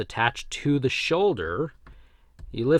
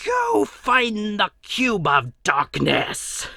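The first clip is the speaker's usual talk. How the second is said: high, loud, drawn-out Australian villain voice; Major Blood Australian accent; 12 semitones higher, 3 dB louder, much slower than usual. Acted. Toy reviewer playing Major Blood answering Cobra Commander, hammed-up Australian accent. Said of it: "best Major Blood Australian accent I got right now".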